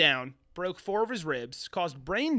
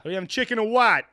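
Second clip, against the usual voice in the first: loud, higher-pitched movie drug dealer line; hammed-up dealer patter, raised voice; 4 semitones higher, 9 dB louder than usual. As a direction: call it out like a dealer doing business. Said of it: "That's how drug dealers talk".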